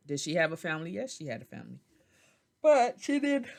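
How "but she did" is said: high, loud yawn swallowing the words; yawning mid-sentence; talking through a yawn. Real, not acted. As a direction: let a real yawn stretch the words. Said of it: "I kinda yawned a little bit".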